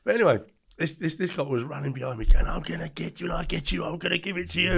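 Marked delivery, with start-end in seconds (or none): 2.5-4.7 unvoiced mock threat from a chasing cop; hushed imitated police threat; hissed cop threat. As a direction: whisper the threat like a copper. Cop's threat, retold.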